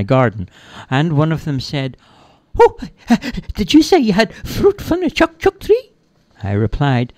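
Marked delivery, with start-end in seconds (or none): 2.6-5.9 squeaky-high, loud pixie voice; high-pitched little magical creature; excited pixie. Acted. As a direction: burst in high and eager. Excited pixie arrives in the story.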